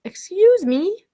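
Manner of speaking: a timid little mouse politely interrupting; tiny mouse. Acted. Little mouse politely interrupting the quarrel, tiny slow voice. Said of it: "this little tiny mouse says".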